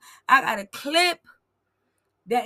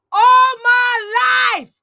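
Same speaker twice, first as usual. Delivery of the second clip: high, very slow cracking yell; outraged shout; 7 semitones higher, 13 dB louder, much slower than usual. Real, not acted. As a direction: bellow it in disbelief.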